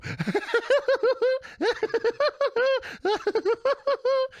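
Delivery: a long, high, hammed-up cackle at the screen. Streamer laughing at a muted stream, long high cackle. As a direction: throw your head back and howl with laughter.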